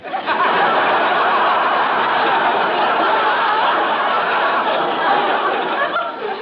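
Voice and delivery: loud rolling belly laugh; big hearty laugh. Acted. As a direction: burst out laughing at your own joke. Friend laughs at own joke.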